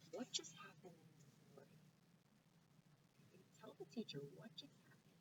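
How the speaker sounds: soft whispered prompt. Shown as a demonstration. Teacher prompting pupils in a whisper.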